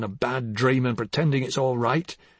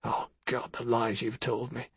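breathy, hushed anguish; despairing hush; bitter hushed despair; 4 semitones lower, 11 dB quieter than usual. Acted. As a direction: let it out under the breath, worn down. Bitter marital quarrel.

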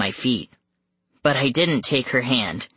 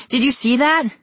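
higher, more frantic shout; screaming louder; 10 semitones higher, 3 dB louder than usual. Acted. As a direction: push the alarm even further. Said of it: "I screamed".

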